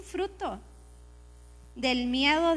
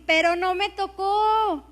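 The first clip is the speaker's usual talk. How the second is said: joyful woman quoted; 6 semitones higher, 5 dB louder than usual. Retold or imitated. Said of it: "me dice".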